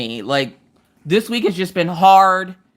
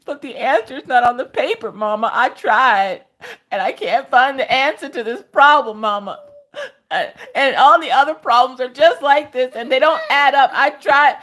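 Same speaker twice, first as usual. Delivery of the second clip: put-on higher, whiny frustrated kid; Maggie; 6 semitones higher than usual. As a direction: imitate a child protesting tearfully to mama. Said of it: "she's getting frustrated and tired and mad".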